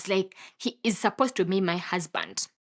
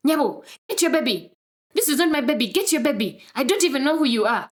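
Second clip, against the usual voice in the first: higher-pitched indignant retort; angry outburst; 6 semitones higher, 4 dB louder than usual. Retold or imitated. Man tells woman the baby isn't his, angry. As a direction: act out a man angrily disowning. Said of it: "burst out very angrily".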